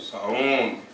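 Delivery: normal talking voice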